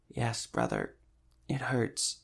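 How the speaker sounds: weak, soft, dried-out voice; dry whisper. A narrator's reading. Sick old woman answers the cleric, dry whisper. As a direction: barely get the words out, softly. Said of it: "could not speak above a dry whisper".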